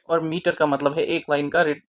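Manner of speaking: normal talking voice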